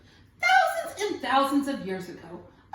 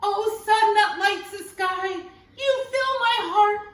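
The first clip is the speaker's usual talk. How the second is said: louder, slow, arms raised to the sun; loud devoted prayer; 6 semitones higher, 5 dB louder than usual. A narrator's reading. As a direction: call it out in praise, slowly. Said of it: "he prayed to the sun".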